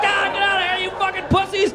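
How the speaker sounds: high, hammed-up angry yell; mock rage. Attacker shakes the car at trapped teens, mock rage. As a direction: imitate a raving attacker.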